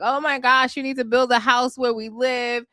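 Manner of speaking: exaggerated high-voiced relatives' complaint; imitating fussing aunts. Acts out the visiting aunts.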